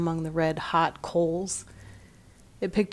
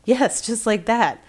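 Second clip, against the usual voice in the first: excited; 5 semitones higher, 5 dB louder than usual. A narrator's reading. Sexton excitedly confirms the meow.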